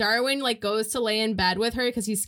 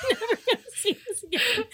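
high, quick taunt through laughter; laughing taunt; 12 semitones higher, faster than usual. Retold or imitated. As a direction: squeeze the words out while cracking up. Cracking up while acting friends' farewell.

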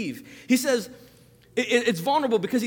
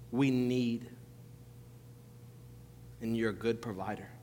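hushed, softer prayer, near a whisper at times; quiet prayer; 11 semitones lower, 12 dB quieter than usual. Acted. Pastor praying for the congregation this morning, hushed prayer. Pastor prays before sermon.

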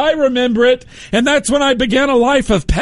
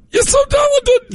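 oversell it with a shriek of praise; gushing high praise; 12 semitones higher than usual. Acted. Gushing praise, repeated flatter.

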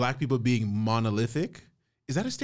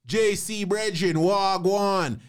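raised, loud mock patois greeting; lightly hammed Jamaican patois hello; 8 semitones higher, 4 dB louder, much slower than usual. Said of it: "come right with Jamaican patois".

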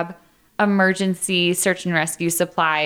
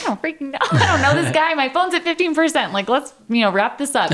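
higher and laughing, fed up; exasperated; 8 semitones higher than usual. Retold or imitated. To the ranger about her questions, laughing exasperation. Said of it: "And I'm like".

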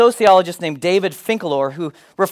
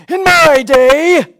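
put-on high, slow old-timer's grumble; 9 semitones higher, 7 dB louder, much slower than usual. Preacher mocking parents with an old-timer voice. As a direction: play the crusty grandparent. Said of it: "leads us to say things like".

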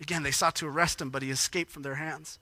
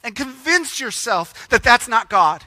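higher and louder, pleading urgently; preacher voicing a desperate, pleading Jesus; 7 semitones higher, 12 dB louder than usual. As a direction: beg them to just look at the works. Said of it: "Jesus is kinda desperate".

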